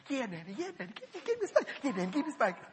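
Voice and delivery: put-on high, soft little-kid voices; little kids' voices. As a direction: play the kids, high and soft. Voicing his little kids.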